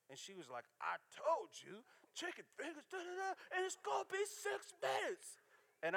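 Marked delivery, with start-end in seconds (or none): 0.8-5.4 much higher, squeaky intercom yell; mock screaming cashier. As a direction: shriek it like the drive-thru lady. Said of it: "she screamed at me through the microphone".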